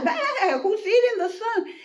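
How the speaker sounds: high bleat into panicked question; alarmed goat. Acted.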